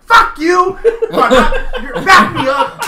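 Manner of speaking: loud, high furious yell, laughing at the end; angry yell. Retold or imitated. Husband yelling at his laughing wife for backup. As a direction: yell at her to back you up. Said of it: "I was legit angry".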